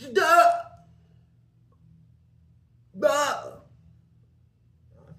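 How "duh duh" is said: high, loud mock "duh"; imitated grunted cry. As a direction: imitate a mute man's sudden cry. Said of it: "First I heard him say".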